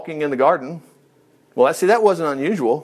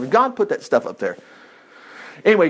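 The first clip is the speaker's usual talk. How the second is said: normal talking voice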